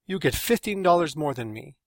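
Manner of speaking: aggrieved outburst tipping into Spanish; indignant exclamation. A narrator's reading. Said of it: "Carlos exclaimed".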